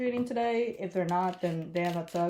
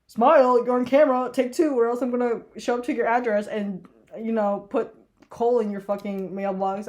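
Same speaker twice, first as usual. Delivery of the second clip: loud, higher mock threatening note voice; mock threat; 3 semitones higher, 7 dB louder than usual. Vlogger reads her pretend threat note to trick-or-treaters, loud and mock menacing. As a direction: bark it out like a spooky warning sign. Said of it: "write down, like, a threat".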